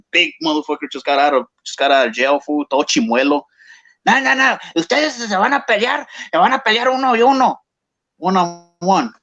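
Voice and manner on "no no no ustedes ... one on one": high, pushy voice of the ex-con father-in-law; father-in-law ordering a one-on-one fight, raised high voice; stern older man. Retold or imitated. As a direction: bark the rules at the two fighters like the boss of the block.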